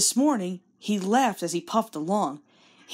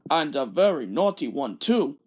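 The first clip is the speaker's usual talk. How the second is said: lower, stern boss voice; stern Fat Controller; 5 semitones lower than usual. Acted. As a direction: tick off the naughty engine. Fat Controller scolds Thomas.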